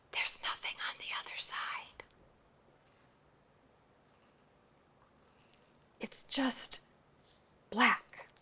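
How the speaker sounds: hushed, breathy whisper of a dark memory; barely voiced, as if telling a secret; whispered confession. [name] on the dying man's experience.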